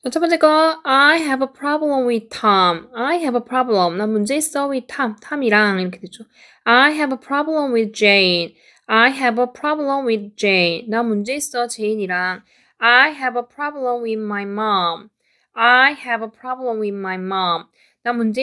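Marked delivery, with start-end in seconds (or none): 0.8-4.0 slow and carefully clear; slow model reading. Shown as a demonstration. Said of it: "쭉 내려가면서 읽어보도록 할게요". 6.6-10.9 slow and carefully clear; slow model reading. Shown as a demonstration. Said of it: "쭉 내려가면서 읽어보도록 할게요". 12.8-17.6 slow and carefully clear; slow model reading. Shown as a demonstration. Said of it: "쭉 내려가면서 읽어보도록 할게요".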